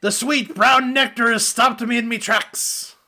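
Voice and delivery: hammed-up pirate woe; lightly voiced theatrical pirate; dramatic pirate.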